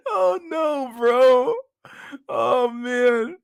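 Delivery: hysterical laughter. Real, not acted. Streamer losing it at the video for chat, hysterical laughter. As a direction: laugh so hard it turns to tears. Watching the walking video live.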